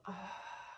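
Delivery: hushed, breathy exhale; soft releasing sigh. Real, not acted. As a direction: let it all out on a soft sigh. Letting it out on the exhale.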